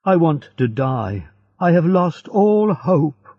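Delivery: despairing cry. A narrator's reading. Prisoner who cannot dig further, crying out in despair. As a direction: voice climbing in despair. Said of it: "he cried".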